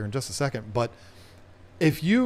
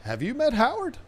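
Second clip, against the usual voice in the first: quoted movie line; 9 semitones higher than usual. Retold or imitated. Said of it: "here's the line".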